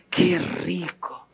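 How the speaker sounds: slow, drawn-out delight; savoring it. Acted. Preacher to listeners, slowly savoring salmon carpaccio. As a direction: taste it slowly and enjoy.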